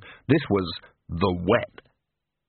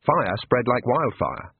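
usual speaking voice

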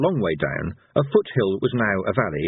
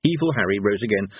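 plain reading voice

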